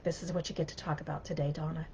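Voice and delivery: God's gentle prompt, hushed and soft; quiet voice of God. Retold or imitated. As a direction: let it drop to a hushed inner voice. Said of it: "God was like".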